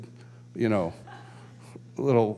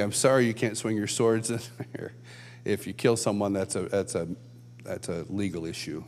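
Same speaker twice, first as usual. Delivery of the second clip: apologetic usher; 6 semitones lower, 8 dB quieter than usual. Acted. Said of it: "have the ushers actually get them".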